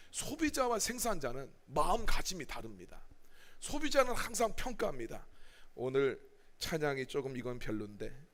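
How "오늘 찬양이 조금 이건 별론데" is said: low, soft grumble about worship; lightly voiced critical churchgoer; mock grumbling critic. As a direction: mutter like a picky consumer.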